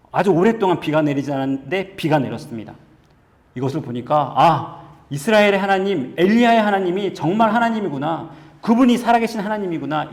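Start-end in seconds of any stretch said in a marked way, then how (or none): 4.4-10.1 raised, emphatic cry of realization; awed realization. Acted. Preacher voicing onlookers who see fire fall for Elijah, awed realization. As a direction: proclaim it higher, as a truth just dawning. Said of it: "이것이 깨달아졌습니다".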